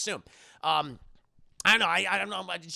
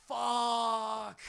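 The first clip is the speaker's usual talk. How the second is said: alarmed curse; 5 semitones higher than usual. Acted. Car coming at kid.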